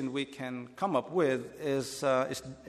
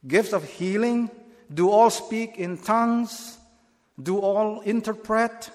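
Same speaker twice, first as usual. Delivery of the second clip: each question climbs higher and louder; 1 rising, emphatic questions; 6 semitones higher, 4 dB louder than usual. Shown as a demonstration. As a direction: press every all, building up. Reading Paul's rhetorical questions aloud.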